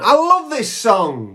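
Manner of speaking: high, slow, delighted English fan; excited English fan. Retold or imitated. Gush it out like a thrilled English neighbour. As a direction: neighbours singing along, gushing over their song, high and thrilled. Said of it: "English people going".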